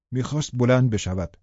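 plain reading voice

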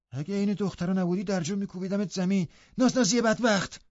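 high, indignant threat; angry. A narrator's reading. Humiliated lad threatening his pampered cousin, angry. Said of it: "جوان عصبانی گفت".

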